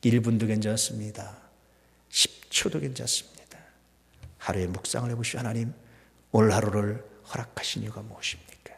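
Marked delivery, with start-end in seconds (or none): none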